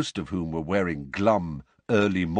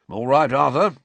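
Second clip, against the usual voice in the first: friendly greeting; 5 semitones higher, 8 dB louder than usual. Acted. Bob greeting Mr Weasley.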